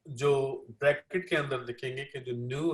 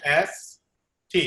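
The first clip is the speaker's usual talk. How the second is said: एस टी with the accent on टी; stress on second syllable; 5 dB louder, much slower than usual. Shown as a demonstration. Translator shows where the accent falls, एस टी stressed on टी. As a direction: separate एस and टी, accenting टी. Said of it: "जो second syllable पे है".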